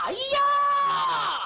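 high, loud drawn-out yell; desperate shout. Acted. Hu Dahai screaming for Nezha to come, long high shout. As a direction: bellow for help at full voice.

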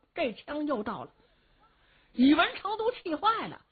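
ordinary delivery, nothing marked

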